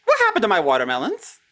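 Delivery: high, loud and fast; alarmed outcry. Real, not acted. Gamer finding the watermelons gone, alarmed outcry. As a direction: blurt it out in alarm.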